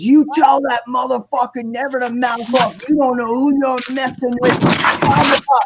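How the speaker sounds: imitated high-pitched angry warning; threatening club owner. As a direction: warn him off like a furious owner. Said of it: "And he goes".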